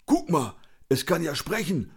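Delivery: high, mocking character voice; jeering mock amazement; teasing. A narrator's reading. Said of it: "frotzelte Conny".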